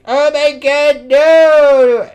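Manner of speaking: high, drawn-out loud "no" in dismay; mock dismayed cry. Retelling Queen Bee's panic in a comic, mock dismayed cry. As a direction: wail it high and slow, hammed up.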